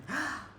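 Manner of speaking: sharp high gasp of delight. Retold or imitated. Shopper finding her size in the box, sharp delighted gasp. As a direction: gasp as if the shoes come in your size. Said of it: "inhale really deep".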